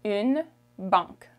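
"banque" in French with nasal A; French pronunciation. Shown as a demonstration. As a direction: say "banque" through a nasal vowel. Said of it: "the A N is a nasal sound".

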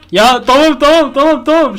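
high, loud frantic repeats of tamam; panicked pleading. Real, not acted. Player begging the attacking monster, high panicked shouting. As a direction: beg in real panic, voice jumping up.

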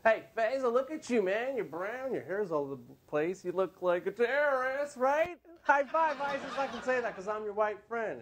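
cocky, high-pitched mock buddy voice. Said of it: "a little too cocky when they're joking with me".

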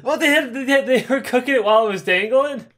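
high, laughing, incredulous question; laughing disbelief. Real, not acted. Incredulous at cooking while dangling.

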